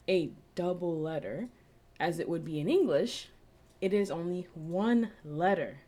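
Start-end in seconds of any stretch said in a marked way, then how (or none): none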